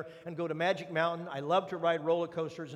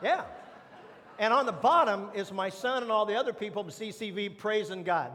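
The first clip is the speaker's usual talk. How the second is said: ordinary talk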